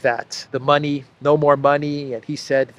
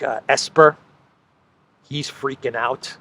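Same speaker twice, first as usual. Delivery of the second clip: usual speaking voice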